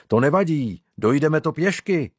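enthusiastic exclamation. A narrator's reading. Arnošt tells the others they can walk there, mildly enthusiastic. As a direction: pipe up with a touch of eagerness. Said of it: "vykřikl nadšeně Arnošt".

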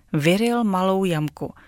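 ordinary delivery

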